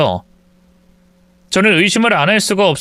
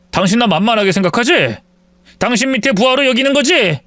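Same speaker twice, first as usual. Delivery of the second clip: husband's irritated voice; 7 semitones higher than usual. Acted. Husband arguing with wife.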